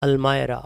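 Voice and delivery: अलमायरा with a "my" in the middle, "al-MY-ra"; English pronunciation. Shown as a demonstration. Teacher models the English word for learners, English pronunciation. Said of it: "English में बोलेंगे तो वो बोलेंगे".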